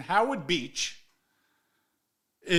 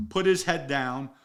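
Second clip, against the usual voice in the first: normal talking voice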